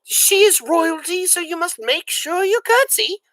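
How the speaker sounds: stern housekeeper voice; dour head servant. A narrator's reading. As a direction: lecture the new girl sternly. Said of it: "a dour woman named Louise".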